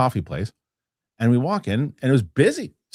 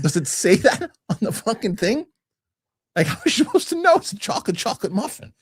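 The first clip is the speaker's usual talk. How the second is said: high, squeaky indignation breaking into laughter; incredulous protest; 9 semitones higher than usual. Retold or imitated. Retelling the retort over the chocolate muffin, incredulous. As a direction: protest in comic, high-pitched disbelief.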